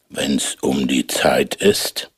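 low, slow giant voice; deep, slow giant speaking. A narrator's reading.

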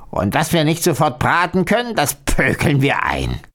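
high, gleeful witch voice; hissing old witch. A narrator's reading. Old witch scheming to the girl about roasting her catch, hissing. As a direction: hiss it greedily like a wicked old witch. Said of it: "Die alte Hexe aber zischelte".

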